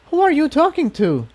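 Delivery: puzzled and a bit scared; nervous pony voice. Acted. Pink unicorn asks Spike who he talks to, uneasy.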